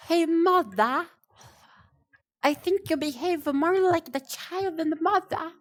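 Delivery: high, lightly voiced kid talking back; imagined child. Imagined child telling the streamer off as a mild mock child voice. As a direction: play the kid scolding their parent. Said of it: "the child would be like".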